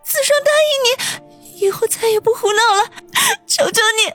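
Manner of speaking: put-on high girlish voice, crying and begging; tearful pleading. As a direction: plead through tears not to be abandoned. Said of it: "颤抖着声音问道".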